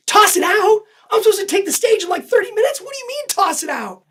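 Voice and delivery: incredulous outburst. Retold or imitated. Thinking inside after his friend says to drop the video, incredulous high outburst. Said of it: "I'm thinking that way inside".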